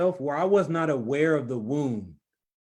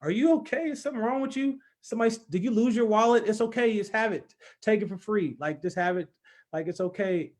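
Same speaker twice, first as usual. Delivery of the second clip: high, fussing cashier voice, much higher than his own; worried cashier; 8 semitones higher than usual. Retold or imitated. Cashier fussing over the crying man, high and worried. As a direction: play the flustered cashier fretting over a crying customer. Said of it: "the cashier is looking at me like".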